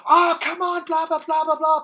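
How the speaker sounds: high squeaky frustrated gamer; mock kid whining at a video game; mock gamer whine. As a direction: whine like a kid losing a round. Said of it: "the ones who go".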